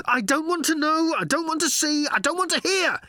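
high, loud frantic yell; yelling while running. Acted. Ford yelling as he runs from robots, frantic high yell. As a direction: shout it mid-sprint. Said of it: "he yelled as he ran".

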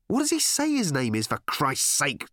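high-pitched, losing patience; exasperated, slowing on the final oath; exasperated question. Acted. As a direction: ask it at the end of your patience. Exasperated questioning of clueless aliens.

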